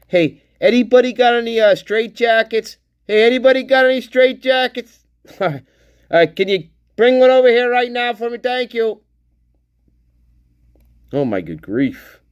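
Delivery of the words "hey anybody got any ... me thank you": high, fast, pinched comic caller; high-pitched mock request for help; mock calling out. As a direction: pipe up in a high, rushed put-on voice. Jokingly calls out for straitjackets.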